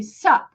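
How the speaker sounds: "Sup" as one short syllable; clipped slang greeting. Shown as a demonstration. Teacher showing what some people say, clipped slang greeting. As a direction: cut the greeting down to just "Sup". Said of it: "some people just say".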